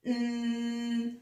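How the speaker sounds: ordinary talk, nothing marked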